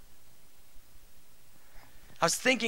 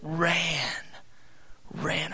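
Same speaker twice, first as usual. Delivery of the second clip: put-on child getting it softly; 2 semitones lower, 5 dB quieter than usual. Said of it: "He goes".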